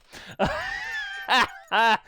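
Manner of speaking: high-pitched laugh; amused high cackle. Real, not acted. Laughing at own banter.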